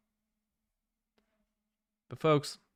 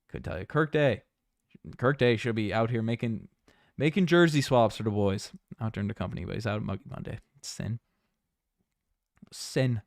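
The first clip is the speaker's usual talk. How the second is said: put-on playful Newfoundland accent. Streamer talking about jersey swaps for the boys, Newfoundland accent. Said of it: "I'll start talking in a Newfoundland accent".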